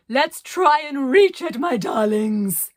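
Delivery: high, loud, slow theatrical howl; howled call. Acted. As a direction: howl it over the wind. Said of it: "she howled".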